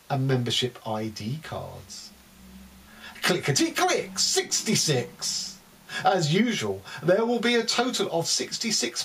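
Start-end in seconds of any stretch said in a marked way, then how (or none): 3.2-5.6 high sing-song bingo call; bingo caller. Pretend. Bingo calls in poem.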